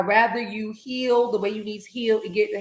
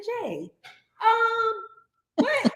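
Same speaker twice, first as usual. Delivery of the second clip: mock confusion; 12 semitones higher, much slower than usual. Retelling confused phone question.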